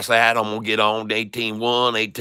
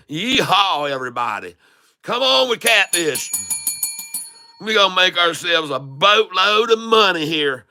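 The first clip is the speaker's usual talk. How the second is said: put-on higher-pitched hollering country boy; 5 semitones higher than usual. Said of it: "switched over to the redneck".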